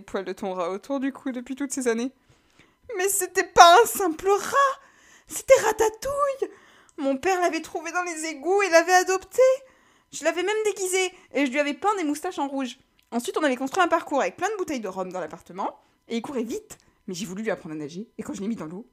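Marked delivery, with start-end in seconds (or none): 3.0-6.5 ému. Acted. Alistair défend son rat. 7.0-15.8 voix de personnage. Acted. Alistair raconte son rat.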